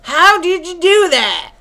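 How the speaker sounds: hammed-up Southern accusation, drawn out at the end; southern accent. Shooter accusing Mort of stealing his story, southern accent. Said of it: "this southern accent from Mississippi".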